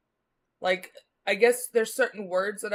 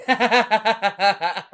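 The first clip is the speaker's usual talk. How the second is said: laughing; 11 dB louder than usual. Real, not acted. Laughs at own edibles story.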